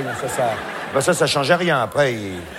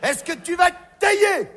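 high, slow, furious yell; caveman yelling; 13 semitones higher, 3 dB louder, slower than usual. Acted. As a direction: curse the stone like a brute. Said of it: "il faisait". (caveman qui hurle, aigu et lent)

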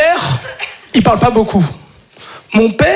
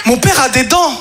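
ordinary talk

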